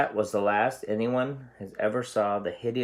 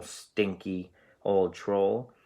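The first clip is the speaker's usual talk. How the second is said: plain reading voice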